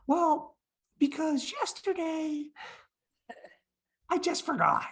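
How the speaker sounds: put-on very high, halting small-girl voice; little girl. First-grade girl answering the visitor, imitated high halting voice. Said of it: "And she went".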